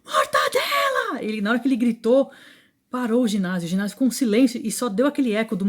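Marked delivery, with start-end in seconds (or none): 0.0-1.2 high, drawn-out mock bellow; imitated yell. Coach yells a player's nickname mid-game, high drawn-out imitated yell. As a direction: stretch it out high, like a coach yelling across the gym. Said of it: "esse homem deu um berro, gritou".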